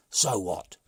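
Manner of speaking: loud, slow grumbling chief; the brigand chief, fed up; slow loud complaint from the chief; gruff chief. A narrator's reading. Chief scoffing.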